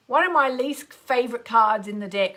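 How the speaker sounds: usual speaking voice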